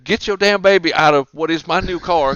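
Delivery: loud mock scolding; berating car thief. Car thief ordering mother to take her baby, mock angry. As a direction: order her about in put-on outrage. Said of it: "he's giving her shit".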